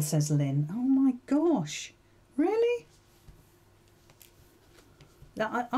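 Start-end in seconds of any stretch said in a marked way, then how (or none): 0.7-2.9 higher, incredulous "Really?"; surprised. Real, not acted. Quilter reacting to a viewer's vote, surprised. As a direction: disbelief at the viewers' choice.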